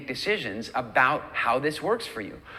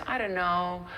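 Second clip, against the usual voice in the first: hesitant excuse; 5 semitones higher than usual. Acted. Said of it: "if you say to your friends".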